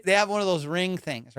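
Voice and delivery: normal talking voice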